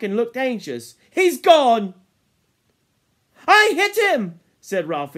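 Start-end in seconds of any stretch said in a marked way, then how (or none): 1.1-1.9 high, loud cry; dismayed shout. Acted. Hunter finding the boar has gone, loud cry. 3.5-4.4 high, loud insistent cry; excited boast. Acted. Ralph insisting he hit the boar, excited shout.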